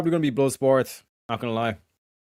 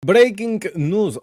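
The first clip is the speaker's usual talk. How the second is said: slow, louder, announcer-style breaking news; mock news announcer; 7 semitones higher, 6 dB louder, slower than usual. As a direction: boom it like a newsreader.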